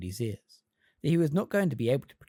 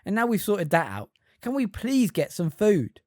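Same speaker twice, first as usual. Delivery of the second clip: irritated; 7 semitones higher, 5 dB louder than usual. A narrator's reading. Ron irritated, hungry.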